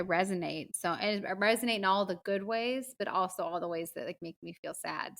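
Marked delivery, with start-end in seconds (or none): none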